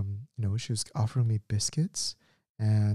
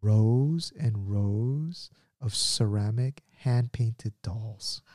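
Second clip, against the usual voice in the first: slow dramatic reveal; much slower than usual. Acted. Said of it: "dramatic reveal".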